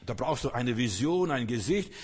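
usual speaking voice

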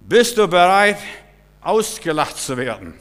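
high loud call, du pushed up; loud challenge. Acted. Preacher repeats his challenge to the congregation, high and loud. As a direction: shout Bist du bereit with du up high. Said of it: "repeated challenge, different voice".

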